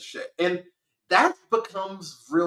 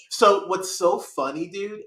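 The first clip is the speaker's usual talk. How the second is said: normal talking voice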